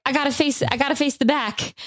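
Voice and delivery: high-pitched mock-overwhelmed quote; imitating tearful brother-in-law. Brother-in-law overcome on stage, mock tearful. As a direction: imitate him fighting back tears, pitched high. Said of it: "he was trying to talk through his crying".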